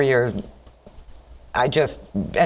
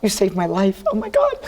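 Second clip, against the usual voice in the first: high, half-laughing, half-crying gush; 7 semitones higher than usual. Retold or imitated. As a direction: swoon over the man who just saved you. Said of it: "I was so mushy".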